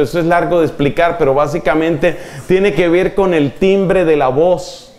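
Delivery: ordinary talk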